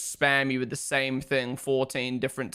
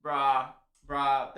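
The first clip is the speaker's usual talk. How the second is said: normal talking voice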